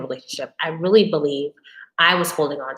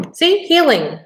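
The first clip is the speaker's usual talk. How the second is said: playful; 11 semitones higher, 7 dB louder, slower than usual. Real, not acted. Playful aside after laughing.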